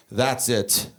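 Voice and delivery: low, final statement from dad; dad's warning. Retold or imitated. Dad driving, to kids in back seat, low terse warning. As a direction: lay it down as a final verdict. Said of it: "He looks back, he goes".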